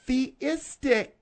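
ordinary talk, nothing marked

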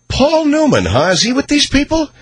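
high, incredulous exclaim; mock shocked. Real, not acted. Host reacting to Paul Newman in the story, mock shocked. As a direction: act scandalized by the news.

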